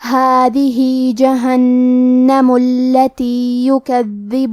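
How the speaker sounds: slow, melodic tajweed chant; Quran recitation. Shown as a demonstration. Teacher reciting the verse to students, slow melodic chant. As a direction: draw each vowel out in measured recitation.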